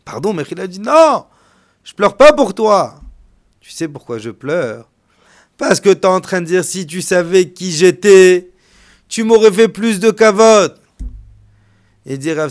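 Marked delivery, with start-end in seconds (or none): 0.8-10.8 emphatic. Acted. Rabbi answering host with emphasis.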